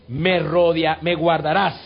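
high, loud, fervent proclamation; preaching at full force. Acted. Pastor rousing the church over music, full-force preaching. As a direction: cry it out to the whole congregation.

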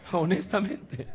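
high word through laughter; real amused laugh in the voice; laughing speech. Real, not acted. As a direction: say it while still laughing. Still laughing after the anecdote.

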